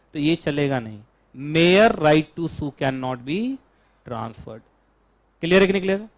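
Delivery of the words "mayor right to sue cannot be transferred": very slow, each word drawn out; slow dictation. Shown as a demonstration. Teacher dictating the rule for students to write slowly. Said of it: "dictating the rule".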